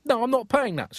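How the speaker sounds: indignant refusal. Retold or imitated. Farmer refusing to pay for the pig, high indignant. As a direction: refuse with shocked disbelief.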